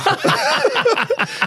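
squeaky burst of laughter; high laugh. Real, not acted. Player laughing while retelling his telling-off, high. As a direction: crack up at the story. Laughing after crowd quote.

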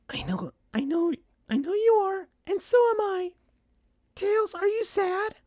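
soft, very high falsetto mother; Sonic's mom, sad and consoling. A narrator's reading. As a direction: console gently in a thin falsetto. Sonic's mom voiced in story.

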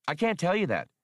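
high, piping computer voice; Max, answering. Acted. Small computer answers the leader's question, high and eager. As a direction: answer brightly as a small machine. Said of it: "Max answered".

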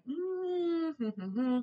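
high, soft wordless hum; imitated nagging hum. Shown as a demonstration. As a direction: hum it softly, high then dropping. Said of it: "it's kinda like".

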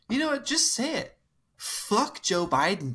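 a mocking drunk voice egging everyone on; drunk cousin. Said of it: "he just goes".

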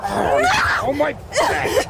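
high yelp of pain, then slow groan; pained cry. Acted. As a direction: cry out hurt in the back. Attacker hurt, cries out.

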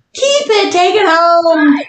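mock exasperation; exasperated. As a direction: brush him off, drawn out. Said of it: "I'm like".

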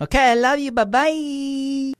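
silly high voice. Pretend. Sign-off in a silly voice.

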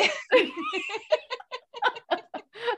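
long high rising laugh; real laugh. Real, not acted. Storyteller cracking up at her own ghost story. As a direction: dissolve into laughter.

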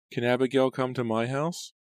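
put-on high eerie sing-song child voice; taunting ghost child. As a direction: call out like a teasing ghost girl.

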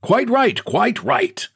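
high, hearty agreement; cried out. Acted. Boss eagerly agreeing with old clerk, crying out. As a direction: agree heartily without listening. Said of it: "cried the boss".

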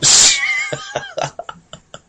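loud, high-pitched laugh; burst of laughter. Real, not acted. Laughing at own joke.

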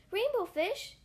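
high little fish voice calling. A narrator's reading. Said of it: "he called".

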